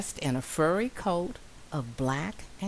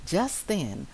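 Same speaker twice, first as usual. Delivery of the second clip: ordinary delivery, nothing marked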